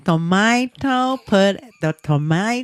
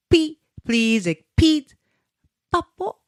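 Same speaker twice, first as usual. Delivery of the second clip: imitating baby; 7 semitones higher than usual. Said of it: "she tell her".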